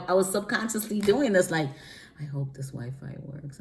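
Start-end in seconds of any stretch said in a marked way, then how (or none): 2.2-3.6 hushed, much softer hope; sneaky hope. Retold or imitated. Sneaking the Wi-Fi on, hushed and hopeful. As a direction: say it under your breath, sneaking. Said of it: "the way I was acting".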